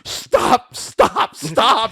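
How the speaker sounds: high, loud frantic pleas; panicked begging. Retold or imitated. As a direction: shriek for mercy as if being torn apart. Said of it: "begging him, like".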